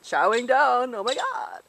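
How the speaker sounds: a hint of valley girl gush; mock her own valley girl voice; lightly voiced valley girl; valley girl imitation. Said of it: "I sound like a valley girl".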